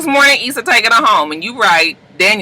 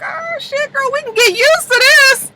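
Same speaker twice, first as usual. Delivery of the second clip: mock passengers. Passengers voiced in recap.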